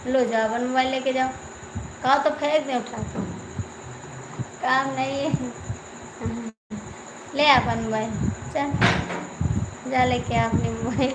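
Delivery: loud, irritated telling-off, pushing the words out; angry scolding. Real, not acted. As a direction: snap at someone to take their phone away. Scolding someone off-mic about phone.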